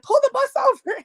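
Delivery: high-pitched joyful shout; bursting with the news; shrieking to husband on the phone; excited yell. Retold or imitated. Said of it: "I said".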